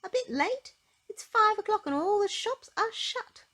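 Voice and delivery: high, loud indignant cry; an exasperated friend crying out in disbelief; cried out. Acted. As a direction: friend scolding a very late Miss Late, high and loud. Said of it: "cried Miss Neat".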